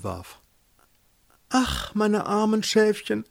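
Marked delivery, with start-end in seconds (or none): 1.5-3.2 high, sighing grand voice; pontificating lady pope. A narrator's reading. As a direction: pity your flock from on high. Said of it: "sprach die Päpstin dann". (salbungsvolle Päpstin, hoch klagend)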